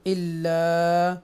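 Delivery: slow chanted tajweed reading; measured chanted recitation; Quranic recitation. Shown as a demonstration. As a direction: recite it slowly and melodically. Recites word in lesson.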